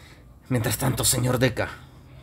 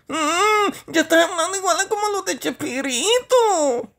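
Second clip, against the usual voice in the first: very high, whiny comic character; high-pitched tearful whine, played for laughs; Toñito; slower than usual. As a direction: complain in a high mock-crying voice. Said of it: "de repente viene Toñito".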